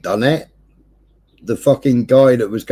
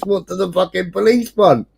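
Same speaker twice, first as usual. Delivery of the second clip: normal talking voice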